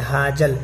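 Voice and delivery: "حاجل" with a hard j; "حاجل" using jeem instead of zaal; common mispronunciation. Shown as a demonstration. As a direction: say "حاجل" as haa-jal. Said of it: "بہت سارے لوگ اس کو حاجل پڑھتے ہیں".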